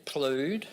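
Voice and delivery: plued with a long OO; rhymes with food. Shown as a demonstration. Speaker tests a nonsense word's sound, plued rhyming with food. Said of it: "knowing how to sound it out".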